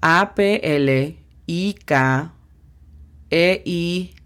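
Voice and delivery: D E S K T A P spelled out slowly, letter by letter. Shown as a demonstration. (deletreo lento, letra por letra)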